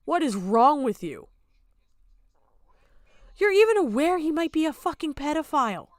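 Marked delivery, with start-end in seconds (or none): none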